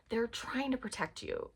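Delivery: ordinary talk, nothing marked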